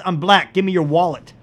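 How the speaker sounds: hammed-up holdup shout; mock robbery line. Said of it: "What he actually said is".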